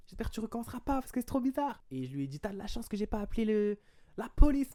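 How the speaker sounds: very high, agitated retold telling-off; worked-up warning. Warning her about calling the police, mock indignant threat. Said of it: "j'étais trop énervé".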